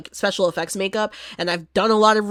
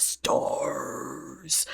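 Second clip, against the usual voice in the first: far softer, voiceless monster rasp; Nemesis growl; 9 semitones lower, 11 dB quieter than usual. Retold or imitated. Imitating Nemesis from Resident Evil, voiceless rasp. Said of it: "He hitting that".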